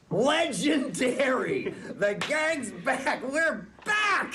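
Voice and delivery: slow, drawn-out triumphant cheer; excited cheer. Acted. Barney celebrating the gang's return, drawn out and excited. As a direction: stretch it out like a victory.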